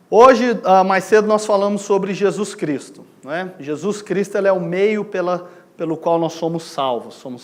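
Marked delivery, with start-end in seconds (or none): none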